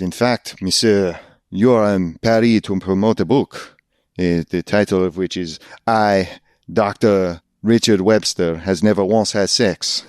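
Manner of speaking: hammed-up bad French accent of a Paris concierge; a lightly done, cheesy French accent. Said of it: "the worst French accent I've ever done".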